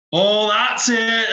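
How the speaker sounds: a quick Scottish imitation of a coworker, stretched out; mock Scottish accent. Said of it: "that's more of a Scottish accent".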